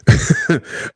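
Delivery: brief amused laugh; short laugh. Real, not acted. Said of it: "I'm saying this thing jokingly".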